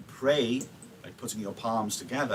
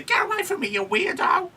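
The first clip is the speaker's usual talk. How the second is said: mock outraged squeal; 4 dB louder than usual. Mock rejection of Romeo.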